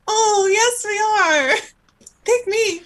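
high-pitched gushing mock of eager women; hammed-up, high fawning voice; eager contestants. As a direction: squeal it like you're begging to be chosen. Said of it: "And they were like".

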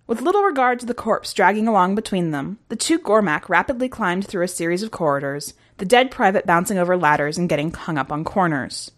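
usual speaking voice

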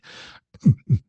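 loud, thumping heartbeat noise; imitated heartbeat. Bandmate imitating the intro heard in the distance, heartbeat thumps. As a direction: mimic a double-beat heartbeat in bursts. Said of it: "that fucking heartbeat sound".

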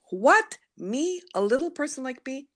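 playing her own doubting self, amazed; disbelief. Speaker answering her inner voice in mock disbelief.

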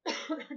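a short dry cough; coughing fit. Real, not acted. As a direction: cough once, allergy tickle. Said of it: "Desculpa a tosse". (tosse seca curta)

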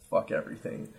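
whispered under the breath; mock despair, whispered; whispered curse. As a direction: hiss it to yourself in defeat. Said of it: "where I'm like".

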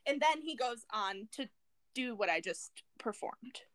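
usual speaking voice

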